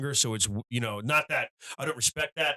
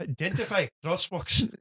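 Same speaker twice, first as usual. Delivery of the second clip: high-pitched mock Scottish; Scottish accent; 6 semitones higher than usual. Host echoing the comic's punchline, imitated Scottish accent. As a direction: echo it in a put-on Scottish accent. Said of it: "in a Scottish accent".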